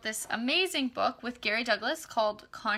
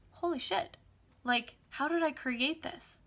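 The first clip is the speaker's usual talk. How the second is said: high, hushed amazement at the money; amazed disbelief; 5 semitones higher than usual. Retold or imitated. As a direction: gasp in happy disbelief.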